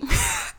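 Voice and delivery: a high burst of real laughter; breaking into laughter. Real, not acted. Laughing at her own mock voice to listeners. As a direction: crack up at her own impression. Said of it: "couldn't even say that with a straight face".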